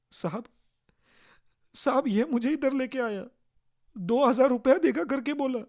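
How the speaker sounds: high, loud mock sob in Hindi; lightly acted tearful accusation; sobbing. Young woman sobbing her story to the police, lightly acted. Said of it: "हुंदके देत ती तरुणी सांगते".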